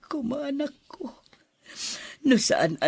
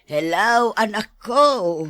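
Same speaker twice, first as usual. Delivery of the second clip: calling out hello; 8 dB louder, slower than usual. Acted. Mother greets arriving child.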